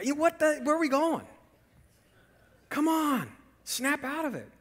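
high-pitched mock exasperation; exasperated. Onlooker in line telling off a rude customer, comically exasperated.

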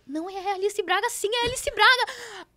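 high-pitched, stretched-out insisting; retold fangirl urgency; excited insistence. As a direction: insist in a rising, starstruck squeal. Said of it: "Aí eu".

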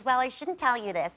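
ordinary delivery

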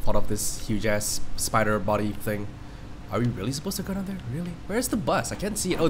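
normal talking voice

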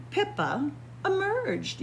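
usual speaking voice

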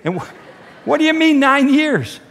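high, loud retold bewilderment; baffled questioning. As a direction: ask it high-pitched, as if thrown by a stranger. Retelling his baffled reply to stranger.

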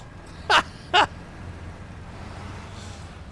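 long breathy high laugh. Real, not acted. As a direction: laugh in delight. Laughs at truck.

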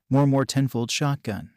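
ordinary delivery, nothing marked

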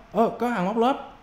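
usual speaking voice

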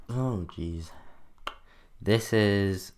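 hiccup catching the words; small gasp of a hiccup. Real, not acted. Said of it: "I have the hiccups".

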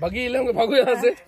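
loud imitated "भागो यहाँ से"; mock scolding. Friend loudly imitating the scolding on the trail. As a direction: throw the retold scold out loud. Retelling being told off.

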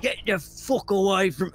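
guttural rough orc roar. Acted. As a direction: growl it like an angry orc. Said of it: "I'm stuck in the orc voice".

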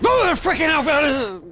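slow, high, cracking yell of fright; real scare, voice spasming high; startled shout. Real, not acted. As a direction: voice jumps up as the scare hits. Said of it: "I just spasmed voice-wise".

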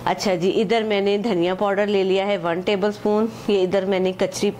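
ordinary delivery

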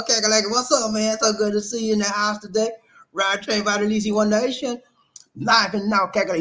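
high, pumped live-stream shoutouts; chanting the crowd into hitting like; hype shoutout host. Acted. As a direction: hype up the chat like a train conductor. Hyped live shoutouts to chat.

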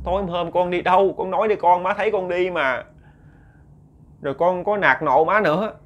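put-on old mother's plea. Old mother questioning her son.

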